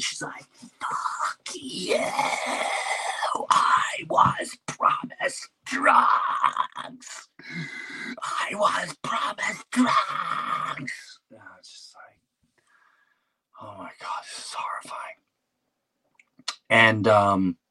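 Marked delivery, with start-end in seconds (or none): 0.6-11.2 high, cracked, crying screech; desperate laboring wife shrieking at the nurses; wife cursing in labor. Retold or imitated. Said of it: "my wife was cursing". 13.6-15.2 horrified whisper. Retold or imitated. Husband horrified, hushed.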